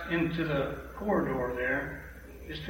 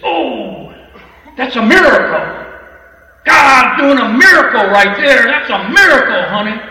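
very loud and high, rejoicing; loud, high "that's a miracle, honey"; ecstatic; 7 semitones higher, 14 dB louder than usual. Retold or imitated. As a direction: shout the miracle like an overjoyed old lady. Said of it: "She said".

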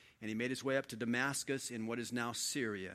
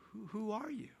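hushed, awed question; 7 semitones higher, 9 dB quieter than usual. Acted. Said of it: "He goes".

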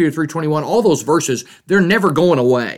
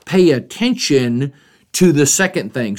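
ordinary talk

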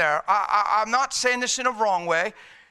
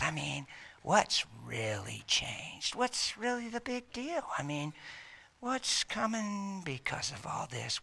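soft, much quieter sly taunting; taunting whisper; 12 dB quieter than usual. Acted. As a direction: slip doubts in quietly. Demon whispering doubts to Paul.

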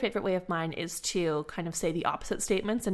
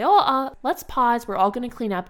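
loud, high startled "oh, uh" halting the play; surprised stop; 4 semitones higher, 7 dB louder than usual. Shown as a demonstration. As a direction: catch the mess mid-spill and call a pause. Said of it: "and you'll say".

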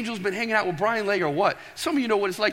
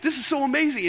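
put-on excited servant girl; 6 semitones higher than usual. Servant girl hearing Peter at the door, excited and breathless. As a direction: gush like a servant girl who just heard Peter. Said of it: "And she gets so excited".

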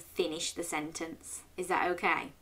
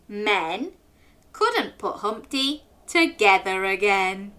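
nursery rhyme; 2 semitones higher, 6 dB louder, slower than usual. Acted. Teacher reciting the rhyme to children, sing-song. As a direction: bounce through the rhyme for children.

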